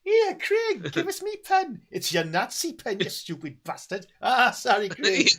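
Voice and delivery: hammed-up high Geordie Nazi squabble; mock bickering in a high Geordie voice; Sarah Millican. Comic Nazis squabbling over a pen, high mock Geordie. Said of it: "Nazis played by Sarah Millican".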